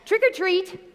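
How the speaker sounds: put-on high-pitched child repeating the cue; imitating a toddler at the door; little girl's voice. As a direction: pipe it up like an obedient three-year-old. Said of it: "So she goes".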